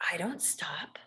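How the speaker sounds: hushed, breathy declaration of never stopping; whispered resolve. Real, not acted. To listeners about persisting, whispered firmly. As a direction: drop to a whisper to mean it. Quiet resolve in a self-help talk.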